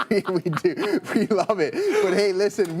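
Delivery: words broken by laughter; real laughter on the words; laughing through words. Real, not acted. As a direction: laugh while you talk. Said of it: "laughing while excited".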